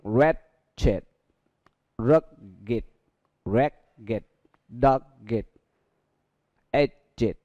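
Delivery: "rugged" and "ragged" with id endings. Shown as a demonstration. Teacher modelling adjectives for students, id ending. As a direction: model each word slowly, ending id.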